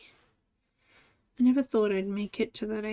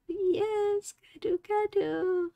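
cooing to the cat; 7 semitones higher, 3 dB quieter, slower than usual. Real, not acted. Cuddling her cat, a high soft coo. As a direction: coo it sweetly to a pet.

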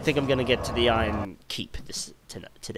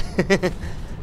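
real, quick higher laugh; amused giggle; 7 semitones higher than usual. Real, not acted. Gamer amused by a fall, giggling. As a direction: giggle straight after the squeal.

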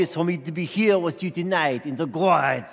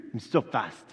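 normal talking voice

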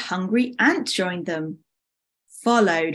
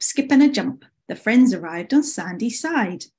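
plain reading voice

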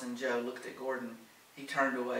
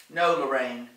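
firm, louder no; immediate objection; 9 dB louder than usual. A narrator's reading. Gordon stops Lorraine from coming, immediate firm objection. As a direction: cut the idea off at once. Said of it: "Gordon said immediately".

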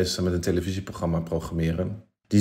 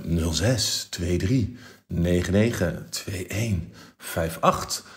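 slow, sultry phone voice; sexy phone voice; 4 dB quieter, much slower than usual. Shown as a demonstration. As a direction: purr the number out slowly. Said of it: "met mijn meest sexy telefoonstem".

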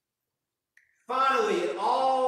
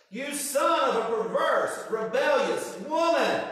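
slow, loud, higher furious insult; enraged king; 5 semitones higher, 6 dB louder than usual. Retold or imitated. King raging at his son over dinner, slow and loud. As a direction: spit each word out in rage. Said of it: "Saul's just lost it".

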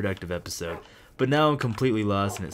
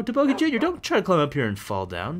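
calling out; 7 semitones higher than usual. Real, not acted. Calling to pet dog.

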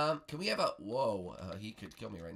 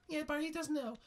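Princess Peach. Pretend. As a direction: squeak a doubtful aside. Peach comments.

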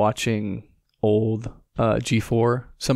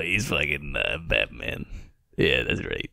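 much lower, softer gravelly-free Batman growl imitation; mock Batman voice; 8 semitones lower, 6 dB quieter than usual. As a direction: drop into a low, hushed Batman impression. Mocking Robert Pattinson's Batman role.

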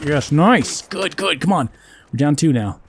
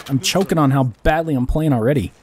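ordinary talk, nothing marked

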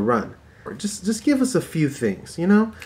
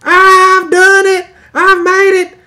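mock triumphant yell; 10 dB louder, slower than usual. A man yells to his wife about his invention, triumphant. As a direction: crow it like a proud home cook. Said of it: "somebody at home yelling at his wife".

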